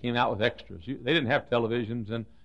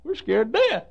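mock fright; 10 semitones higher, 8 dB louder than usual. Preacher voicing John frightened of being left alone, mock fear. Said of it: "I think John said".

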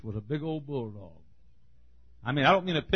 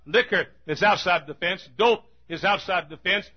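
preaching; 5 semitones higher, 4 dB louder than usual. Acted. Preaching, list of sins.

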